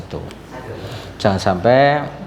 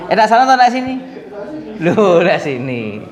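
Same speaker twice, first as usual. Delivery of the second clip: teasing laugh; 5 semitones higher than usual. Real, not acted. Teasing patient during massage.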